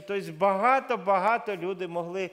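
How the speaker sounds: ordinary delivery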